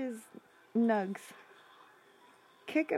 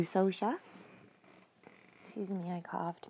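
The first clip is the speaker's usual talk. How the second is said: normal talking voice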